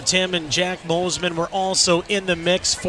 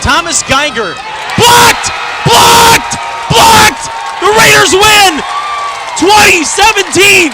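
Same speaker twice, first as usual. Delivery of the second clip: ecstatic shout; 14 dB louder, slower than usual. Real, not acted. Game-winning block called live.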